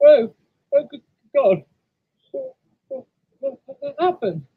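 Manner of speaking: high, stammering startled voice; shocked. Acted. Engine wakes to find he has stopped, shocked. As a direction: stumble over the words in alarm. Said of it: "shocked that he'd stopped".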